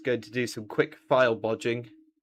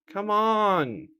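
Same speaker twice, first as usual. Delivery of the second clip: exasperated pleading with a machine; 8 semitones higher, slower than usual. Real, not acted. Software still not loading.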